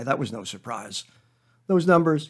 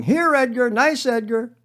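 higher and louder, teasing call; children chasing the puppy, calling out higher and louder; kids yelling; 10 semitones higher, 4 dB louder, slower than usual. Retold or imitated. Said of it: "running after it and yelling".

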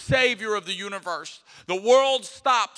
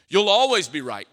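louder repeat; 8 dB louder than usual. Acted. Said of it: "repeat louder".

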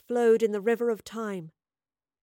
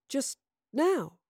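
halting, higher questioning echo; struggling to remember; 5 semitones higher than usual. A narrator's reading. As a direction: repeat it while searching memory. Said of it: "struggling to remember".

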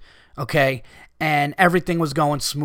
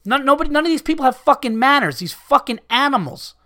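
real exasperated rant, pitched higher; pissed off; 6 semitones higher, faster than usual. Real, not acted. Customer venting about people without manners, genuinely pissed off. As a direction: rant in earnest, voice raised in pitch. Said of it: "It's how pissed off I am about it".

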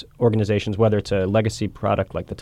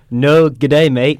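put-on Australian accent; 4 semitones higher, 7 dB louder, much slower than usual. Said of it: "that was my attempt to get that".

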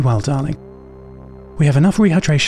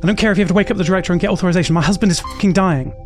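fast, desperate and pressing; frantic urgent plea; 5 semitones higher than usual. Acted. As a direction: beg them to abort now.

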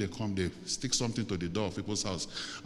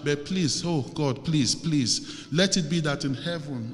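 pleading prayer; 3 semitones higher, 3 dB louder than usual. Acted. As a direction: lead them in an urgent, imploring prayer. Leads congregation in pleading prayer.

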